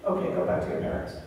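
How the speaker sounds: put-on quick hushed whisper to the kids; playful whispered send-off to grandchildren; hushed aside. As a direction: shoo them off quietly, fast. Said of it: "grandchildren visit".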